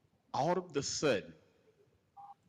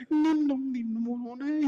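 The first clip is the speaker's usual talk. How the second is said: high, slow panicked repeated no; squeaky flustered man backing out; panicked manager; 5 dB louder, slower than usual. Retold or imitated. Manager caught walking in.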